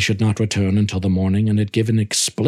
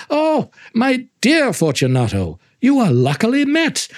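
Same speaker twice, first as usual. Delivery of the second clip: high, gushing false friendliness; syrupy host greeting an old friend; Montresor's fawning greeting; 9 semitones higher than usual. Acted. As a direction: pour on the sweetness to flatter him. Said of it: "I said to him".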